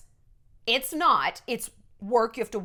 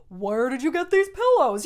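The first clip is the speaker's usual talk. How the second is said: louder gushy mock interview question; softball interviewer; 2 semitones higher, 5 dB louder than usual. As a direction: parody a fluffy at-home interviewer. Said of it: "like, softball".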